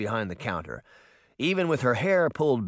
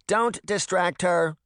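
put-on high, slow old-lady scolding; high, drawn-out old woman's complaint; little old woman; 7 semitones higher, 3 dB louder, slower than usual. A narrator's reading. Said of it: "said a little old woman".

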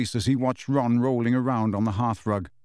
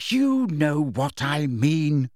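drawn-out, slow impatience; impatient; 2 semitones higher, much slower than usual. A narrator's reading. Hermione to Harry, who dodged her question, impatient. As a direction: stretch each word with pointed impatience. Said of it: "said Hermione impatiently".

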